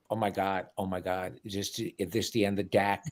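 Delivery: low, hushed mock dread; soft, low parody of hand-wringing; mock alarm. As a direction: act out overblown dismay under your breath. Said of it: "they're making it like".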